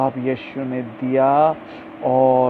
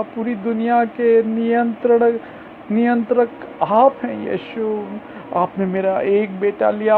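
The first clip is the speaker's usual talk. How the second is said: tearful prayer; 8 semitones higher than usual. Retold or imitated. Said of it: "मेरी mummy आंसू बहाने लगी".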